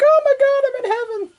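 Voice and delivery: high squealing delight, fading to a hush; blissful squeal. Acted. Reviewer voicing a girl getting her beer back, ecstatic squeal. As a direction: gush in ecstatic joy. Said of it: "she's like".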